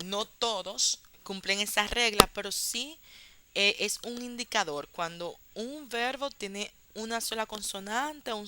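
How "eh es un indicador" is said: ordinary talk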